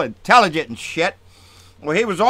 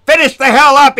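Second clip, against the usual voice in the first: mock Gowdy yell; 8 semitones higher, 9 dB louder than usual. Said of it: "he said".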